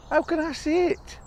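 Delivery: high, coaxing and enthusiastic; high eager bird voice. Acted. Bird coaxes the mouse to come see, high and eager. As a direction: paint the sights high and eager.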